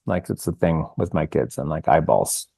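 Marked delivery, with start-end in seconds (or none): 1.9-2.5 a goofy low-voiced dad calling for "Eyeballs."; playful dad catchphrase. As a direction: drop the voice way lower for a silly "Eyeballs.". Dad's playful catchphrase to kids.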